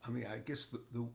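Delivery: faint and far from the mic; too quiet. Real, not acted. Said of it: "Speak louder".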